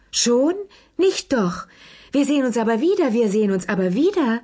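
high, fast, fluttery pleading; fussing hostess, hurried and high; flustered protest. A narrator's reading. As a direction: protest in a high-pitched rush as guests leave. Flustered hostess protesting departing guests.

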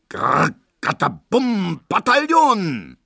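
high, loud, drawn-out imitation of a crash; booming skittle crash. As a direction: boom it out slowly over the din.

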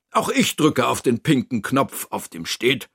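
ordinary delivery

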